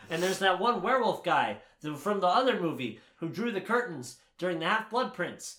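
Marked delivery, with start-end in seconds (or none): none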